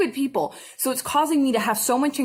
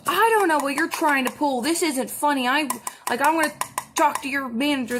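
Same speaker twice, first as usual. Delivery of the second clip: higher, indignant complaint; mock angry customer; 4 semitones higher than usual. Irate caller threatening to get the manager, imitated indignantly. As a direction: imitate a yelling caller, pitched up. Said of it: "she's yelling at me".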